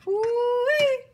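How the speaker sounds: high, loud delighted cry; thrilled at the steak, high and loud; excited ooh-wee. Real, not acted. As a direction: squeal with delight. Delight at the food.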